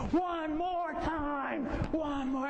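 high, stretched-out chant of eager riders. Retold or imitated. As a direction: beg for another ride in a high yell. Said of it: "we started yelling louder".

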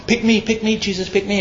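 high, small begging voice; mock pleading disciples. Preacher mimicking disciples vying for Jesus' favor, mock pleading. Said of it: "Gripe, gripe".

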